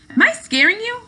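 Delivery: put-on light, high girlish teasing. Jen teasing a jumpy Luke.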